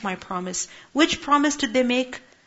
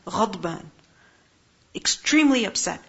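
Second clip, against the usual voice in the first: normal talking voice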